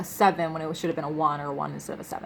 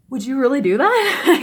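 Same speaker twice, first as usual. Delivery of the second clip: incredulous laugh; 5 semitones higher, 7 dB louder than usual. Retold or imitated. Disbelief at the auction threat.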